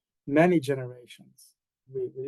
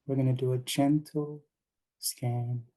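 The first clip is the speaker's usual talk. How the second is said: unhurried meditation guidance with long pauses; calm guide giving breathing cues in short, spaced phrases; guided meditation. Acted. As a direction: lead them gently into relaxation, leaving space between phrases.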